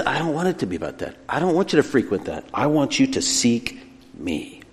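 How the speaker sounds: soft, earnest plea as God; quiet, measured entreaty; gentle appeal. Acted. God's appeal, voiced by preacher.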